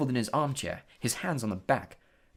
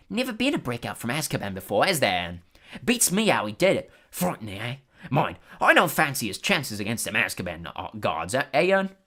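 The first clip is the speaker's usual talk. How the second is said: gossipy bus conductor; 4 dB louder than usual. Acted. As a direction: spin the tale with relish. Stan gossiping on.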